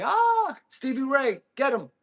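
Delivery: high, loud cheering; hyped call-out. Real, not acted. Streamer jokingly cheering a viewer on, high and loud. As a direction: egg him on, high and loud.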